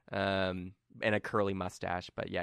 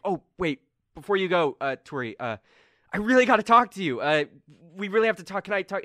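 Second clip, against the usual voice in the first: higher, louder pleading; Colm urgent; 9 semitones higher, 8 dB louder than usual. Acted. Game master as Colm begging Turi for a private talk. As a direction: press to be heard.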